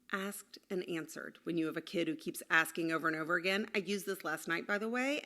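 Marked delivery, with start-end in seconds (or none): none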